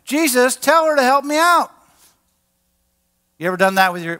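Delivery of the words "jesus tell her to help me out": high-pitched mock tantrum plea; throwing a fit. Preacher as Martha begging Jesus for help, mock fit. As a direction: complain up high, as if wigging out. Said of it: "throwing a fit".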